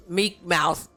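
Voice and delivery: ordinary delivery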